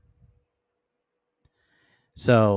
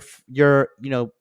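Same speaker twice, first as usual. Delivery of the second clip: ordinary talk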